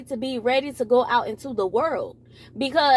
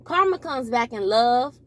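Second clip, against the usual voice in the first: usual speaking voice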